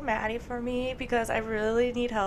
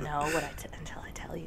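teasing secret whisper; 6 semitones lower, 6 dB quieter, faster than usual. Acted. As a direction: whisper it, keep them guessing. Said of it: "That was like a sexy secret".

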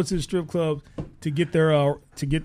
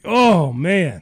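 dismayed groan; 6 dB louder than usual. Retold or imitated. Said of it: "your girl's like".

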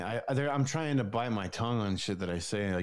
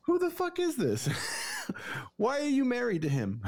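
much higher, strained disbelieving voice, laughing through it; incredulous Mark Wahlberg; 12 semitones higher than usual. Retold or imitated. Podcaster acting out the movie husband scene, high and outraged. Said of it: "He's like".

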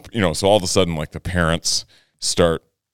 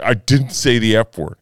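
put-on higher-pitched, insistent kid's denial; adamant denial; 6 semitones higher, 4 dB louder than usual. Son to questioning parents over swearing, adamant denial. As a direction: imitate a child firmly digging in, pitch raised. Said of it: "Just, like, adamant".

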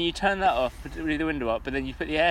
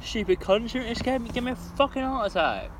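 jokey rant at the pigeon that scared her; mock rant; 8 semitones higher than usual. Real, not acted. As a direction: rail at the pigeon half jokingly. Ranting at the dead pigeon.

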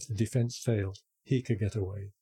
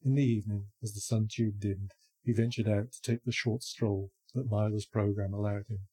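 ordinary delivery

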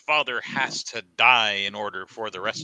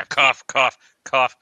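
loud spoken cough cough; fake cough; 4 semitones higher, 8 dB louder than usual. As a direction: say cough loudly as a hint. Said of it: "side note, cough".

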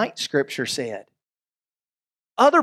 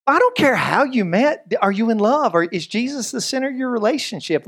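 ordinary talk, nothing marked

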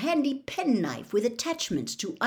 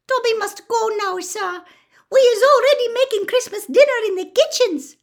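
very high, loud squeaky elf voice; Dobby; 10 dB louder than usual. A narrator's reading. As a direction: squeal an eager farewell as a little elf. Elf announces he must leave.

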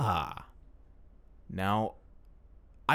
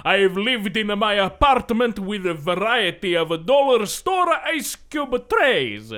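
put-on slow, higher sing-song Italian accent; 4 semitones higher, slower than usual. Reading a review aloud to a friend in an Italian accent. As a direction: read the review like an old Italian man. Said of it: "you're just doing an Italian accent".

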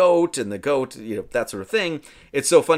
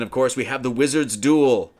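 ordinary talk

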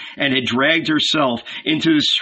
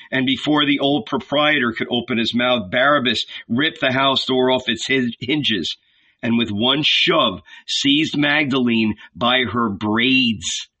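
ordinary delivery, nothing marked

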